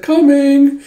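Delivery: high, loud sing-out call; calling out in answer. Acted. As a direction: sing out a reply to a summons.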